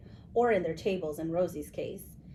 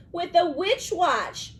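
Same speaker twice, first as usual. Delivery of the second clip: slow, louder and higher witch punchline; 10 semitones higher, 6 dB louder, slower than usual. A narrator's reading. Witch answering the skeleton's riddle, slow witchy voice. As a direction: ham up the old witch's answer.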